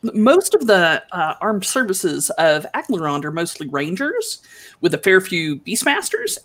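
usual speaking voice

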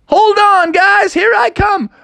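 high, loud eager call; excited shout. Acted. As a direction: yell it while running to jump in. Froggy shouts before jumping in.

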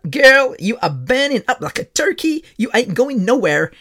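sassy nurse. Retold or imitated. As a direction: tell her off like a no-nonsense nurse. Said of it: "She's like".

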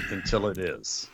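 a whispered afterthought; whispered punchline. Real, not acted. Co-host whispering the punchline about the match. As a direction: drop it to a whisper for the joke. Joking aside after throat clear.

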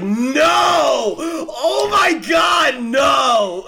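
high shouted wail of despair; anguished shout. Retold or imitated. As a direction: cry out in high, loud anguish. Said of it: "And I was just like".

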